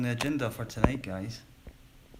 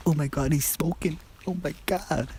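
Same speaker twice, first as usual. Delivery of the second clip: higher, mock-shocked gasp of disapproval; pitched-up scandalised tutting; mock outrage; 8 semitones higher, 3 dB louder than usual. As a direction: parody the shocked onlookers. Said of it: "For all you people say".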